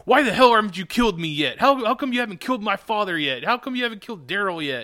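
hammed-up, challenging questions in a rush; mock indignant demand. Mimics Carl challenging Negan.

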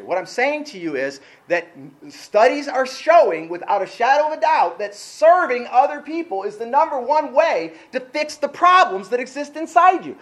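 ordinary talk

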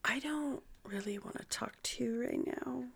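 very quiet, near-whispered brush-off; Nurse Jackie. Acted. Doing a TV nurse brushing someone off, near whisper. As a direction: drop to a whisper, coldly dismissive. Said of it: "doing it like Nurse Jackie".

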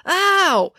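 high, sudden "ow"; high-pitched cry of pain; yelp of pain. A narrator's reading. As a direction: yelp as if stepped on. Joe's head is stamped on.

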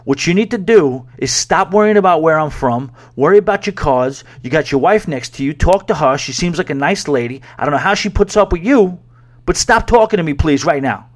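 retold exasperated rant, pushing harder toward the end; heated mock telling-off; losing it. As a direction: tell him off, reenacting your irritation. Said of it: "I legitimately lost it on him".

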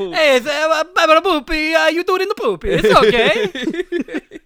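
high, loud, hammed-up Italian-accented pope; sing-song jolly pope, way up high; Pope Francis impression. Pitching the super Italian pope, hammed-up high papal voice. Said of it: "That's, that is Francis".

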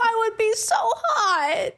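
laughing. Real, not acted. Laughing about having red hair.

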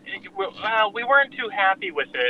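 boxed-in sound, like from a car; inside a box. Real, not acted. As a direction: voice muffled as if in a can. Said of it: "It sounds like you're in a tiny box".

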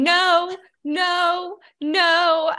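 high, sing-out repeated refusals; mock refusal. Imitating a wife rolling up the paper mid-carving, high mock refusal. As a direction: call each one out high and separate.

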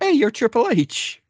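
high, slow, goofy put-on minister voice; mock minister. Retelling the wedding, the minister in a very high slow silly voice. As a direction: play the clueless minister, drawn out and very high. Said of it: "the minister was like".

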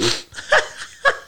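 a high, airy burst of laughter; high breathless laugh. Real, not acted. Cohost laughs at Karen's claim.

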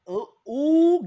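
high, loud burst of delight; excited ooh. Real, not acted. Host suddenly excited by a guest's Netflix topic, high and loud. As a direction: jump in with sudden glee.

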